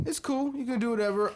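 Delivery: high squeaky mock voice.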